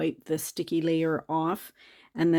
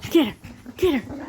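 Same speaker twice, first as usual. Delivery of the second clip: pet talk; 8 semitones higher, 5 dB louder than usual. Real, not acted. Playing with the dog.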